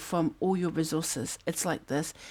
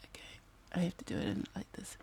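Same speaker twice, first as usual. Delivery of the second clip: whispered aside; 11 dB quieter than usual. Real, not acted. Whispers while placing cards.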